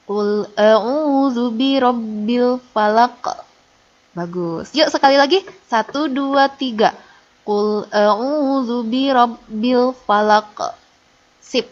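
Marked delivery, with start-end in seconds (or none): 0.1-3.4 slow chanted tilawah; slow Quran recitation. Shown as a demonstration. Teacher leads class recitation in slow chant. 7.4-10.7 slow chanted tilawah; slow Quran recitation. Shown as a demonstration. Teacher leads class recitation in slow chant.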